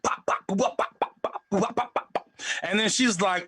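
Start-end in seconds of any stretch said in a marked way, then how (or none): 0.0-2.3 unvoiced beatbox pops. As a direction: spit percussive pop sounds. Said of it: "I'm like".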